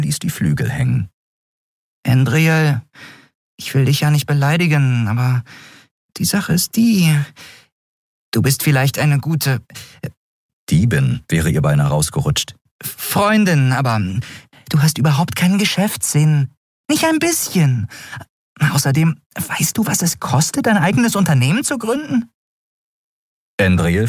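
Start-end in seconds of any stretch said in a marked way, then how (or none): 2.1-9.6 high-pitched character voice, picking words carefully; hesitant Nelen. Acted. 12.8-22.3 very high, pert companion voice; high voice, piling up objections; critical Nelen. Acted. Voices Nelen lecturing a friend.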